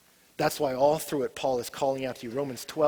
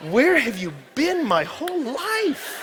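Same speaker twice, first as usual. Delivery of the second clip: savoring; 10 semitones higher, 4 dB louder, much slower than usual. Acted. Savoring the first taste.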